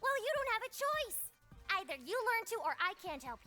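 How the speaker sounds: insist, out of patience; exasperated insistence. Acted. Love overruling Tabby's refusal to open up, exasperated.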